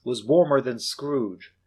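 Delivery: usual speaking voice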